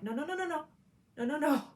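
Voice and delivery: high, rapid string of protests; head them off with high, hurried refusals; urgent objection. Retold or imitated. Retelling urgent warning to churchgoers.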